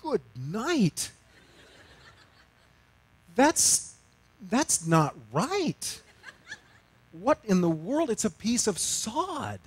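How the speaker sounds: incredulous inner thought. Retold or imitated. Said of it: "I even thought".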